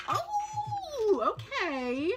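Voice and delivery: high, long-held "oh" sliding down; impressed reaction. Retold or imitated. Player watching the Overwatch League, impressed high coo. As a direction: coo it high, pleasantly surprised. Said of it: "and I'm like".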